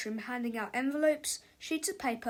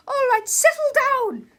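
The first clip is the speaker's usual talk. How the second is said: loud, high teacher's shout; bellowed classroom order; 11 dB louder than usual. A narrator's reading. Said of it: "shouted Miss Ribble".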